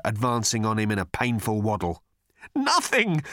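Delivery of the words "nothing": insistent. Acted. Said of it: "insisted Arthur".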